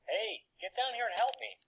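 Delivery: high, whiny plea for help; whining. Acted. Boy whining at robot friend to help carry rocks. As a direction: whine at him to come down and help. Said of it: "Despite Tim's whining".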